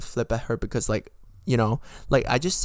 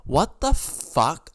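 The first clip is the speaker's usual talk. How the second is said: slow, louder, drawn-out disbelief; exasperated outrage; 4 semitones higher, 5 dB louder, much slower than usual. Real, not acted. Ranting about how rude she was, exasperated. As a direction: stretch it out in indignation.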